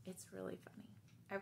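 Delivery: secret whisper. Real, not acted. Teacher whispers to children about a funny story.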